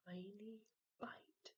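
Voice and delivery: hushed whisper. A narrator's reading. Narrator describes sneaky bite, whispering.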